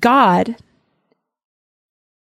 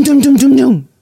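knocking noise; 4 semitones higher, 5 dB louder than usual. Retold or imitated. Knocking on Lot's door.

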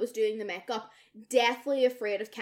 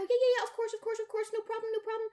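much higher, rushed yes-yes; bright hurried placating; eager agreeing; 12 semitones higher, much faster than usual. Retold or imitated. Eagerly agreeing to fetch cat.

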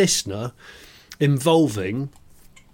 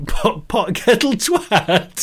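laughing; 8 semitones higher, much slower than usual. Real, not acted. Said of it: "That's my new favourite term".

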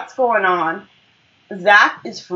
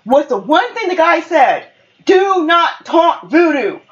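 exasperated scolding; 7 semitones higher than usual. Retold or imitated. Aaron scolding Zach about the voodoo rule, exasperated. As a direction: lay down the rule, imitating a fed-up friend.

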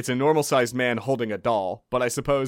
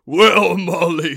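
loud giant voice; 6 semitones higher, 11 dB louder, slower than usual. Acted. Giant answers loudly.

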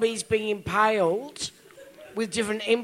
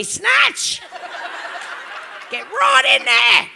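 high, hammed-up yell; triumphant mock shout; 12 semitones higher, much slower than usual. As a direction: holler it in comic revenge. Acting out revenge with his towel.

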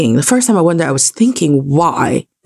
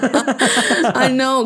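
laughing; 8 semitones higher than usual. Real, not acted. Both laugh at the joke.

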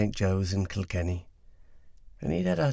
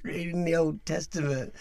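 laughing; 10 semitones higher than usual. Real, not acted. Laughing while echoing partner.

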